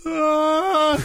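high-pitched "aah" imitating a distant rant; mimicked shrill outburst. Shown as a demonstration. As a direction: let out a high, held mock shriek. Mimics shrill rant bleeding into music.

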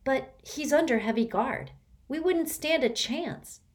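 startled, pulling back from the idea; taken aback. A narrator's reading. Sticky balking at Kate's rescue plan, alarmed. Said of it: "Sticky was taken aback".

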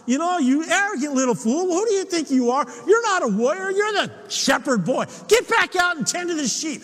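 acted scolding, pitched well up; indignant dressing-down, played out for listeners; angry older brother. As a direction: tell off the kid brother in a high, heated voice. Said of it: "his brother gets angry at him".

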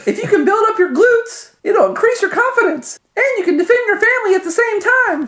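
squeaky falsetto muscleman; very high, loud jock voice; high-pitched voice. Acted. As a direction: greet the crowd in a very high falsetto. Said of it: "says in a high-pitched voice".

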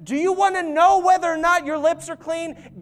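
high, urgent pulpit exhortation; impassioned preacher driving the point home; full-force preaching. Real, not acted.

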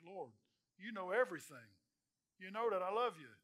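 loud, anguished plea on the third asking; pleading Peter. Retold or imitated. Peter, grieved, pleading loudly with Jesus. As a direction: cry it out as a hurt, insistent friend. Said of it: "Peter's like".

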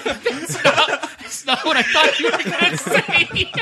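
high, laughing disbelief. Real, not acted. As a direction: laugh it out, caught off guard. Surprised by the friendship answer.

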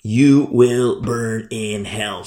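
very slow, each word laid down; slow mock sentence of doom; slow condemning quote. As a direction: spell out the doom slowly. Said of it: "to tell a person".

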